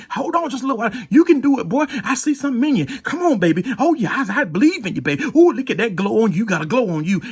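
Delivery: high, excited encouragement; encouraging cheer. Retold or imitated. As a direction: lift him up with joy. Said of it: "pushing us and saying".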